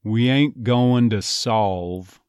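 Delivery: "going to" as "go-in-duh" in "We ain't going to solve"; reduced linking. Shown as a demonstration. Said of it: "So that's one possibility".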